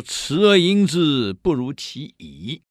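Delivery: classical recitation. Shown as a demonstration. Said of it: "老子有一句话讲得真好".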